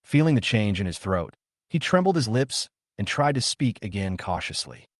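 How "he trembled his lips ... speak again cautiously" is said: plain reading voice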